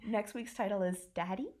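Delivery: normal talking voice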